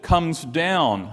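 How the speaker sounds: "comes down" leaned on firmly; key word stressed. Shown as a demonstration. Preacher showing the psalmist's repeated word, stressed "comes down". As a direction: make "comes down" stand out in the verse. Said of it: "he's emphasizing that word".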